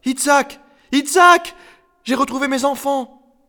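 very high, excited yell; bellowing. A narrator's reading. Ruby calls Itzhak to share the news, bellowing. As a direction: holler for your husband at the top of your voice. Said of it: "et beugla".

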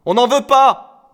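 high, loud, stretched refusal; defiant girl. A narrator's reading. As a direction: shout the refusal slowly. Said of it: "dit Bretelle". (refus aigu, fort, étiré)

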